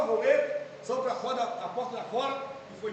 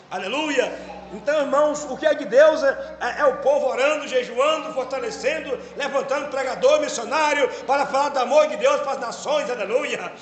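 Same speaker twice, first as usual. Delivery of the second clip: preaching; 5 dB louder than usual. Acted. Pastor preaching to the church, loud and fervent. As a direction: preach it to the congregation with fire.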